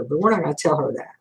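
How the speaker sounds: normal talking voice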